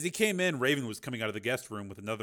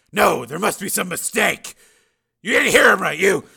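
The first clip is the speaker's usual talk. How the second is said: loud, high protesting disbelief; forceful denial rising in pitch; insistent denial; 9 semitones higher, 14 dB louder than usual. A narrator's reading. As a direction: refuse to accept it, pushing harder. Father in denial of bad news.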